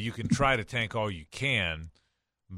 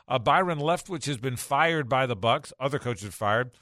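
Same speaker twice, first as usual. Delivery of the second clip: upbeat, louder promo read; radio host tease; 4 semitones higher, 3 dB louder than usual. Acted. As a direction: sell the next segment.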